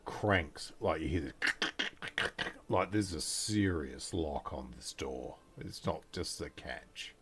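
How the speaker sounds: low, hushed scene setting; game master's narration. A narrator's reading. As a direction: drop low and soft to build atmosphere.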